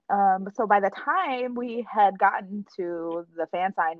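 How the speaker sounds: normal talking voice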